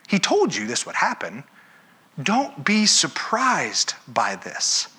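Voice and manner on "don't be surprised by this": usual speaking voice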